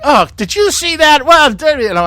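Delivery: high, mock-excited retelling of his own outburst; hammed-up high voice of himself in the crowd; mock indignant self. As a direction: squeak it out like a man caught ranting.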